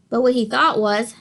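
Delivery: ordinary delivery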